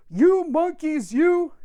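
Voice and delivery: storybook peddler telling off monkeys; cross peddler. Acted. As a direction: wag a finger and scold the monkeys. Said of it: "he said".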